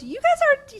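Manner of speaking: very high imitated squeal; gushing. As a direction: start cooing over a cute pair, way up high.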